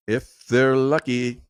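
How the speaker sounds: slow, drawn-out, measured words; slowed down for weight; deliberate repeat. Real, not acted. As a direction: stretch each word out to let it sink in. Slow repeat of the same remark.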